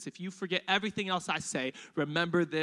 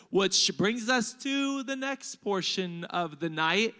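normal talking voice

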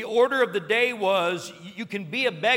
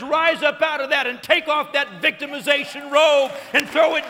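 high, loud, fervent preaching; excited preaching; 7 semitones higher, 9 dB louder than usual. Real, not acted. Preacher urges congregation to throw off victimhood, excited. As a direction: preach it at full force, fired up. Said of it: "Pardon me for getting excited".

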